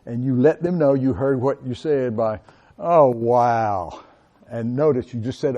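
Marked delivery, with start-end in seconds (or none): 2.8-4.0 sympathetic oh wow. Shown as a demonstration. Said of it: "said it with a tone that".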